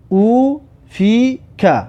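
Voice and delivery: slow, with clear gaps between syllables; syllable-by-syllable reading. Shown as a demonstration. Teacher repeats the sentence for learners, slowly split into syllables. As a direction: separate "او فی که" into three held syllables. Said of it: "این جمله را بخوان".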